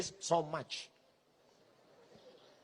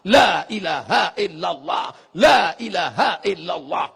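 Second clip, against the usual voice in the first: shouted dhikr, louder on each la; 7 dB louder than usual. Shown as a demonstration. Preacher demonstrates loud dhikr to drive away spirits. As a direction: call out the dhikr at full force. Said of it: "Itu tadi zikir kuat-kuat".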